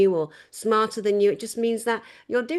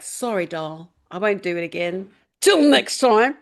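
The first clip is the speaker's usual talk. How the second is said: a light put-on Australian accent, louder at the end; mock Australian accent; 2 semitones lower, 4 dB louder than usual. Apologising to the next Aussie, mock Australian accent. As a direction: play at being an Aussie saying sorry. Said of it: "I'll be like".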